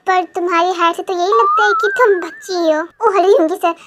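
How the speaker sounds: ordinary talk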